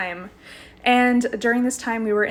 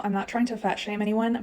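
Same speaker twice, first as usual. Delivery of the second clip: ordinary talk, nothing marked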